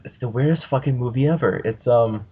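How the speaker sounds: normal talking voice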